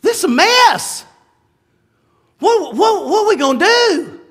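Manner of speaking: high-pitched acted-out alarm; hammed-up helpless shock, stammering; mock shocked panic. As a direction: panic theatrically, voice jumping up. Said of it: "shocked and all going".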